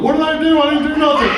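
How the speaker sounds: mock the son's whimper; whiny son. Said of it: "he's crying like a little bitch".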